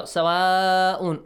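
سواء with the "aa" held for five or six counts; long held vowel. Shown as a demonstration. Teacher showing the madd, سواء with a long held "aa". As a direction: stretch the middle of سواء before the final "un". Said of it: "for six counts or five counts".

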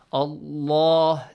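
Allah with a thick, dark L; heavy Lam in Allah. Shown as a demonstration. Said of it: "must be pronounced with a heavy sound".

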